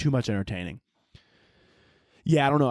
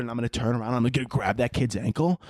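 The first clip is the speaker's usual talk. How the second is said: usual speaking voice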